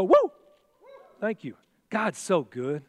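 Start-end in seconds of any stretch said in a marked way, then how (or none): 0.0-0.3 high, loud whoop for the crowd; shouted woo. Shown as a demonstration. Preacher cueing the church to shout, high loud whoop. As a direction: lead the congregation in a big woo. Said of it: "Somebody go".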